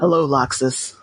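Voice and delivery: low, sultry dusky greeting. Acted. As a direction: drop into a low seductive purr. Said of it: "I would say dusky".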